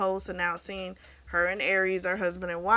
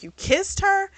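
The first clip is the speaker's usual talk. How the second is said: shocked accusation; 12 semitones higher, 3 dB louder than usual. Retold or imitated. Jealous ex confronting him.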